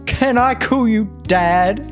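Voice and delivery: ordinary delivery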